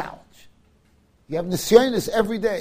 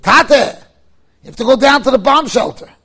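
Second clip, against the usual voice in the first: urgent plea; 6 semitones higher, 9 dB louder than usual. Retold or imitated. Son urging father to shelter.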